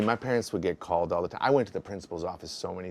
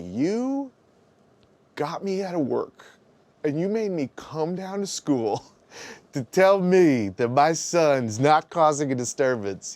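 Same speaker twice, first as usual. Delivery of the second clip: exasperated mom; 6 semitones higher, 4 dB louder, slower than usual. Retold or imitated. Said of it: "then she's like".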